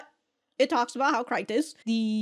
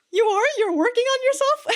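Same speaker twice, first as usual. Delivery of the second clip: much higher, loud gushing disbelief; amazed Adora; 14 semitones higher, 7 dB louder than usual. Retold or imitated. Adora reacting to Catra working on herself, high excited gush. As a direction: gasp it out, thrilled and astonished.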